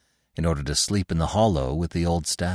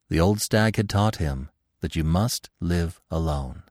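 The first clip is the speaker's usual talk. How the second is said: ordinary delivery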